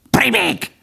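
very high bird squawk; screeching jay. A narrator's reading. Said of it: "rikácsolta a szajkó". (szajkóként rikácsolva)

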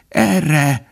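high strained call for help; a broken, feeble cry. A narrator's reading. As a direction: call out weakly, voice breaking. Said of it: "kiáltott valaki megtört hangon".

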